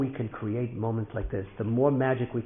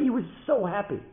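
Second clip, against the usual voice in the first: higher-pitched delight at normal volume; happy emphasis; 9 semitones higher than usual. A narrator's reading. Father reports his son's joy, happy and higher. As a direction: tell the joy with a lifted SO. Storyteller repeats line for emphasis.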